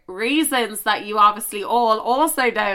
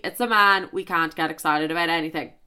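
ordinary talk, nothing marked

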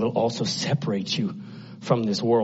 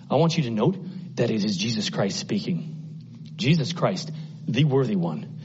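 usual speaking voice

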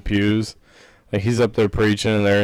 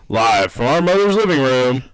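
ringmaster; 3 semitones higher, 4 dB louder than usual. Acted. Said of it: "The Hump Pod".

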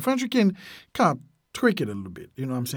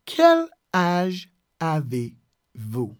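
"Quel... âge... avez... vous", long gaps between; very slow, word by word; much slower than usual. Shown as a demonstration. Teacher showing the question for someone not close, very slow, word by word. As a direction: dictate it at half speed. Teacher breaks phrase into words.

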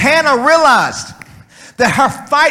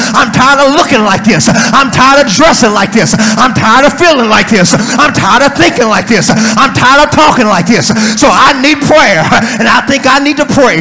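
rhythmic, relentless sermon build; full-force preaching; 5 dB louder than usual. Real, not acted. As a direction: pile up each phrase in a driving preacher's cadence.

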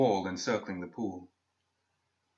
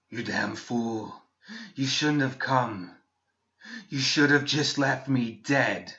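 slow, grinding voice like metal on stones; gravelly voice; 3 semitones higher, slower than usual. Acted. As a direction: scrape every word out slowly. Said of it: "her voice was gravelly, like metal on stones".

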